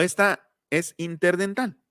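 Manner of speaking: usual speaking voice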